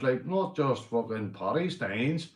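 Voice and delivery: usual speaking voice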